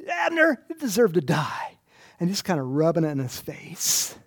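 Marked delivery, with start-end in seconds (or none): 0.1-1.8 higher, louder mock taunt; taunting call. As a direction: jeer at him, then let it drop. David taunting Abner.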